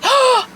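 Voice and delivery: loud, high gasp of surprise; sharp excited intake of breath; delighted gasp. Real, not acted. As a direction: gasp at a happy find. Opens bag and sees gift.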